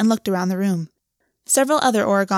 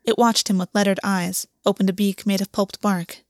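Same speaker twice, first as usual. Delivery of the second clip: ordinary delivery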